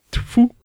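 high-pitched spitting "tfu"; досадливый плевок. A narrator's reading. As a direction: spit it out in disgust. Плюёт с досады. (досадливо сплюнув)

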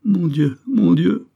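a touch of weeping, rising on the second; tearful cry. A narrator's reading. Madeleine cries over her lost child, lamenting. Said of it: "se mit à pleurer".

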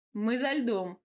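lightly cheerful call; a touch of merry announcement. A narrator's reading. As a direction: toss it out cheerfully. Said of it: "услышала весёлый голос Гавра".

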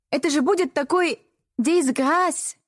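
put-on French accent. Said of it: "намеренно сильнее обычного прокартавила букву «Р»".

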